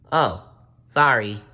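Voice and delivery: small embarrassed servant; sheepish squire. Acted. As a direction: apologize quickly to the king. Squire apologizes after fanfare.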